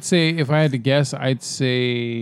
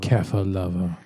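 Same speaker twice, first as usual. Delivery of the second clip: low mocking voice; 7 semitones lower, 5 dB quieter than usual. Echoes the tease in a low voice.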